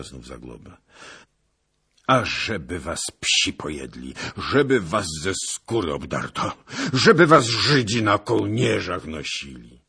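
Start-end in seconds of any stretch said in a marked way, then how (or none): 2.1-9.8 coraz głośniejsze, rozpaczliwe przekleństwa; desperacja. A narrator's reading. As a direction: klnij z narastającą rozpaczą. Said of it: "W głosie Zagloby brzmiała prawdziwa desperacja". (Zagłoba przeklina wilki z rozpaczy, gniewnie)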